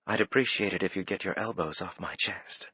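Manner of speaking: lightly voiced woman, cool and composed; calm woman's voice. A narrator's reading.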